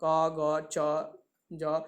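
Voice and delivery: very slow, each sound held apart; slow letter demo. Shown as a demonstration. Teacher giving students examples of alpaprana letters, slow and separate. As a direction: lay out each letter slowly for the class. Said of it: "जैसे क, ग".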